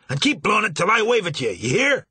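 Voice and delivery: loud, high and fast call; shouted order. Acted. Marsh telling Kitch to keep blowing, fast loud shout. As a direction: rush the order up at full volume.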